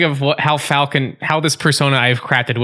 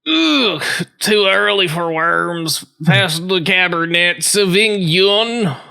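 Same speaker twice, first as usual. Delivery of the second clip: high groan, then slow drawl; languid, raised-pitch character voice; weary falcon detective; 5 semitones higher, much slower than usual. Acted. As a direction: drag the words out, reluctant to be up. Plays the detective Falcon in game.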